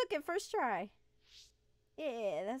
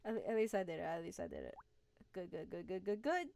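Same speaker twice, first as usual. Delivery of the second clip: normal talking voice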